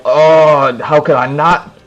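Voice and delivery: swelling "oh", then gushing protest; retold fervent excitement. Developer reminded of Mega Man Zero, acted gushing excitement.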